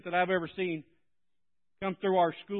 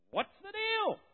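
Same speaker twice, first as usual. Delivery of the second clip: puzzled; 5 dB quieter than usual. Retold or imitated. Surprised fan caught singing.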